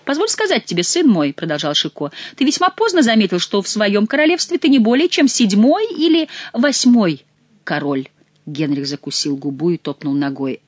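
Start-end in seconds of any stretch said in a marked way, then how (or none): 0.1-8.1 high, loud mocking jester voice. A narrator's reading. Jester Chicot mocking King Henri, loud and high. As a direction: lecture the king like a fool.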